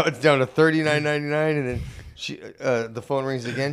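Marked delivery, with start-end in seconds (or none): none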